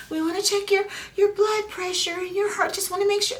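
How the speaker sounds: high, sing-song nurse voice, mocking; mimicking the nurse. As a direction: chirp it like a nurse at the bedside.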